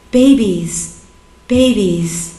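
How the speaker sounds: lightly voiced neighbor calling his cats; a restrained imitation of calling pets, each word set apart. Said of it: "I'd hear him call for his cats".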